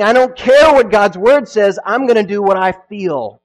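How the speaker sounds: loud, stubborn, self-willed; defiant declaration. Retold or imitated. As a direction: proclaim it with defiance. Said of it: "They're saying".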